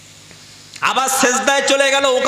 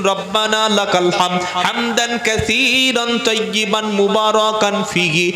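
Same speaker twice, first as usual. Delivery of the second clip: usual speaking voice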